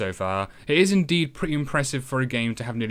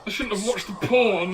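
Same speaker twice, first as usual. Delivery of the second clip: higher put-on voice of a rattled heroine; a mock-spooky inner voice; a hearing-voices character voice; haunted voice; 8 semitones higher than usual. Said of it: "as she starts to hear voices".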